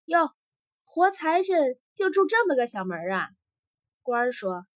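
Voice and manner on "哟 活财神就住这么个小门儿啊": lively, raised-pitch amused surprise; tickled soldier scoffing in amusement. A narrator's reading. Soldier at the rich man's shabby little door, amused. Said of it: "一个士兵乐着说".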